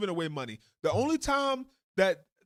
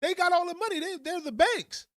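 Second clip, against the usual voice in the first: a hammed-up outraged citizen; mock outraged citizen; 10 semitones higher than usual. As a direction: blow up like a fed-up taxpayer. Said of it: "we'll be like".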